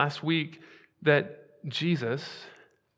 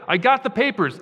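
mock boast; 8 semitones higher, 8 dB louder than usual. Mock Nehemiah waving papers.

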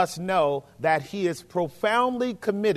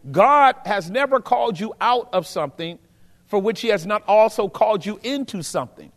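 preaching; 3 semitones higher than usual. Real, not acted. Preaching at full force.

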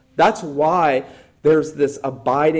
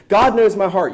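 "God knows my heart" tossed off higher and louder, mocking; mock unbeliever; 6 semitones higher, 6 dB louder than usual. Preacher mocking what unbelievers always say, mock-smug. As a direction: quote the unbeliever's excuse with a sneer. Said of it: "unbelievers always say".

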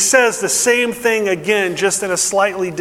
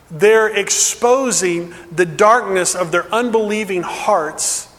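ordinary talk

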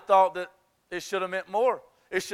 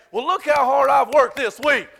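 higher, louder smug self-praise; mock boast; 6 semitones higher, 9 dB louder than usual. Worshippers boasting to God, hammed up. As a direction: brag with mock pride.

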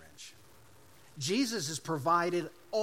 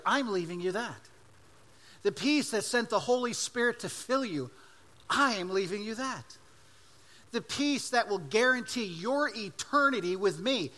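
preaching that builds higher and louder line by line; rising preaching; 4 semitones higher, 4 dB louder than usual. Acted. Pastor to congregation, building fervently on what Jesus leaves. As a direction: pile each phrase on the last, climbing toward the peak.

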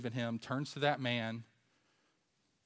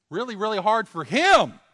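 much higher and louder, pressing the point; loud emphatic cry; 8 semitones higher, 13 dB louder than usual. Real, not acted. Preacher to congregation, loud emphatic cry. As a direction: raise the voice and hammer it home.

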